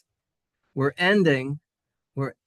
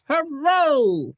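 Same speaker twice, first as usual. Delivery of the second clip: cheer; 11 semitones higher, 5 dB louder than usual. Acted. Teacher cheering points earned.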